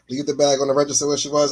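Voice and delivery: ordinary talk, nothing marked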